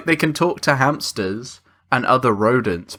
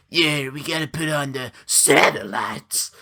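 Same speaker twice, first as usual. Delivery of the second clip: weird put-on voice, sudden emphasis on SATELLITES; odd cartoon voice that leans hard on satellites; Nick Cage. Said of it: "emphasize random words and sentences".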